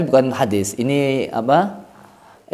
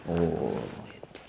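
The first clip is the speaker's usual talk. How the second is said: whispered aside; 11 semitones lower, 16 dB quieter, slower than usual. Acted.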